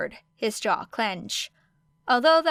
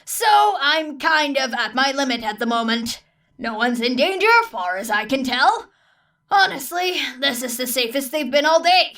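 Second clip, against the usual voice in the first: a harried teacher at his limit, pushed a bit up and louder; exasperated engineer; 4 semitones higher, 6 dB louder than usual. A narrator's reading. Teacher complaining to Aizawa about his student, exasperated. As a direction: voice Power Loader fed up with the mess. Power Loader character voice.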